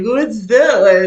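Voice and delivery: loud, drawn-out puzzled question; mock bewilderment, loud and slow; retold puzzled question. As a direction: stretch the question out, playfully baffled. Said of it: "I was like".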